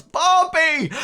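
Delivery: high, loud cheer of a name; crowd shout. Acted. Somebody in the rabble hails Bartleby, high shout. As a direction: finish the chant with a high yell. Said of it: "then somebody shouts".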